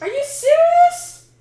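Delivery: high, loud and slow: are you serious; exasperated disbelief. Real, not acted. Voices missing on the Chromebook.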